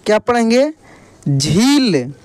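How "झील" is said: झील with a long ī falling low; drawn-out long ī. Shown as a demonstration. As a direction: stretch the ī in झील. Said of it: "खींच कर किया जाता है". (झील की ई खींच कर)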